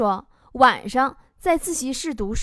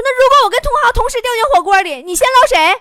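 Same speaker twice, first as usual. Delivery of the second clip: loud, high, rushed demand; mock indignant questioning; 9 semitones higher, 7 dB louder, faster than usual. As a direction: press the question like a sulking boyfriend. Imitated angry boyfriend presses again. (模仿男友着急地高声追问)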